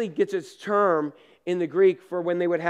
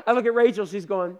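usual speaking voice

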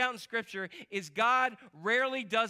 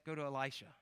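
hushed tip; 6 semitones lower, 9 dB quieter, faster than usual. Acted. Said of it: "An officer says".

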